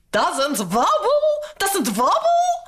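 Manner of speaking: a cook caught off guard, sputtering; flustered cook. A narrator's reading. Cook disbelieves the princess, flustered rising. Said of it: "flustered the cook".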